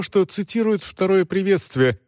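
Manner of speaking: ordinary delivery, nothing marked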